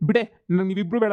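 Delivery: ordinary delivery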